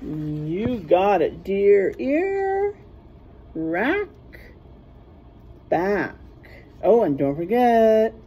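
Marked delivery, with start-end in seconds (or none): none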